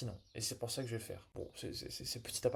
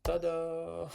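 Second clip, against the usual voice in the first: loud, higher ta-da reveal; playful fanfare; 5 semitones higher, 6 dB louder than usual. Real, not acted. Coder celebrating a small step for viewers, playful fanfare. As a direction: sing out a triumphant little ta-da.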